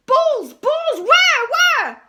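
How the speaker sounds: very high, very loud repeats; frantic shouting. A narrator's reading. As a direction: yell in a frenzy of searching. Frantic teacher searching.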